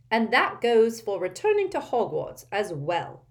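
final stern warning; angry. A narrator's reading.

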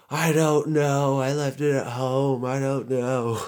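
loud, high, drawn-out wail; hammed-up cornered protest; mock desperate excuse. Cornered suspect explaining missing insulin, mock desperate wail. Flustered lie to the police.

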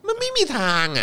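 high incredulous no way. Real, not acted. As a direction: squeak out the disbelief. Mock disbelief at price.

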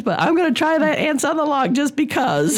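high, bright excited quote; gushing cousin. Retold or imitated. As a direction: gush about trying it. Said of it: "my cousin from Chicago text me".

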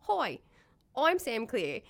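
high, loud Australian greeting; broad Aussie hi. Retold or imitated. Retelling Sam's first video intro, broad Australian accent. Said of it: "it was just".

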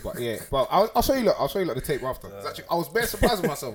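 ordinary talk

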